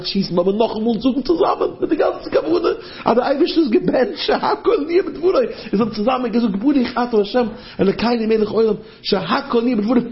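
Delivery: high, urgent group blessing; acted battlefield fervor; fervent acted prayer. Acted soldiers praying together under fire.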